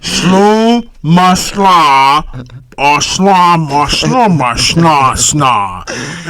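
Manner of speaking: very slow, drawn-out imitation of a mumbler; slowed-down mumbling. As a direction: drag out each garbled syllable in mockery. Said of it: "he slowed it down".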